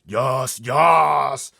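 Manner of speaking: loud high booming yes; booming giant. Acted. Blood Bottler booming at the BFG. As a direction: boom it triumphantly. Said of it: "boomed the Blood Bottler".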